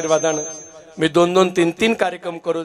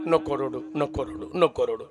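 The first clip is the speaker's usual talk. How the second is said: soft consoling; 5 semitones lower, 8 dB quieter than usual. Retold or imitated. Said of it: "तुम्ही काय म्हणतात".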